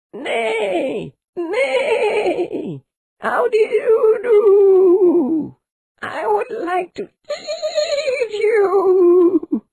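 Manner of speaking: very high, slow whinnying horse; shrieking storybook horse. A narrator's reading. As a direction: neigh it out, loud and stretched. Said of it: "said the horse".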